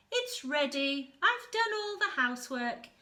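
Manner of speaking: high, loud cheerful call; eager welcome. Acted. Bristleworm calling crab and anemone into the new shell, high and eager. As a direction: call them in happily.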